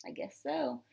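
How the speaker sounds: plain reading voice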